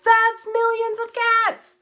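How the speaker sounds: high, slow, delighted exclamation; delighted recognition. Retold or imitated. Seeing her childhood book on a chart, high delighted exclaim. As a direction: squeal it out like finding an old friend. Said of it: "And I was like".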